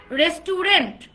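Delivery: loud রেস্টুরেন্ট in three syllables, "res-tu-rent"; common mispronunciation. Shown as a demonstration. Teacher showing how we usually say restaurant, loud mispronunciation. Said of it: "রেস্টুরেন্ট বলি কিনা আমরা?".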